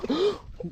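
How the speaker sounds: high startled intake of breath; sharp surprised gasp. Real, not acted. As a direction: gasp at the sight. Sees the big fish.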